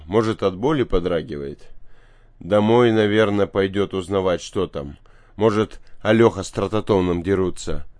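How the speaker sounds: ordinary delivery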